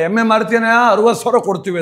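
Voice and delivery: loud high offer from passers-by; buyers calling out. Retold or imitated. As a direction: call out from the road to strike a deal. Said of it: "ಅಂತಾರೆ".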